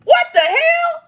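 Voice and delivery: high, loud shout of disbelief; outraged shout. Real, not acted. Ranting to viewers about the cousin, a high indignant shout. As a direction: blurt it out, scandalised.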